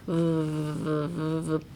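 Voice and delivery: v held with buzzing lips; buzzing v sound. Shown as a demonstration. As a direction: make the lips vibrate on v. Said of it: "make a vibration with your lips".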